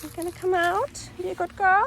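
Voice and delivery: high sweet coaxing call; high coaxing call to the dog; coaxing pet voice. Real, not acted.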